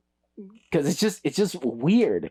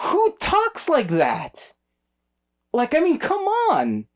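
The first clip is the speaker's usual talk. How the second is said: much higher, slow incredulous complaint; exasperated disbelief; 13 semitones higher, slower than usual. Real, not acted. Complaining to a friend about the dialogue, high and incredulous. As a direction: pitch it up in mock outrage. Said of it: "It's like".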